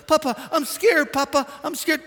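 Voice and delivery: put-on high, slow frightened child voice; scared grandson. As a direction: whimper it like a three-year-old. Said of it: "This is my grandson".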